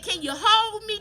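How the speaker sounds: pleading cry for help. Retold or imitated. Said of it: "Baby, I said".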